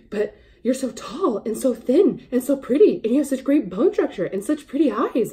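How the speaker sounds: high, loud, breathless string of compliments; gushing associate. Retold or imitated. Store associate telling a customer she should model, gushing loudly. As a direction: gush like an awestruck fan, pitch swooping up on each compliment.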